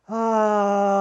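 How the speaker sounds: dramatic sigh. Shown as a demonstration. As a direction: sigh it out loud and long. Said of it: "one more time".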